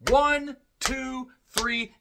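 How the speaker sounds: high excited count of the pin; mock referee count. Reviewer counts the pinfall for viewers, excited. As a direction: count it out like the crowd.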